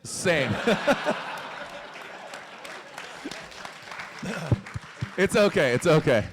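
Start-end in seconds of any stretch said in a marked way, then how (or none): 0.6-5.1 long breathless laugh. Real, not acted. Host cracks up at shy teen.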